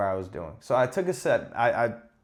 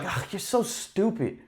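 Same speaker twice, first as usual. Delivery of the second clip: high teasing parent voice; mocking parents; 8 semitones higher, 5 dB quieter than usual. Parents calling me stupid, high teasing mimic. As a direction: tease like a dismissive parent. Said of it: "they'll look at me and be like".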